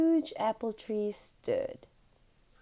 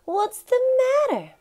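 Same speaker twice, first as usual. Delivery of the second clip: put-on much higher, louder boyish voice asking; worried boy; 9 dB louder than usual. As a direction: ask in a high, concerned child voice. Albie worried for Mrs Johnson.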